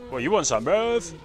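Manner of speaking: loud, rising taunt; high, shouted street challenge. Pretend. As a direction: square up and yell it. Taunts the mammoth.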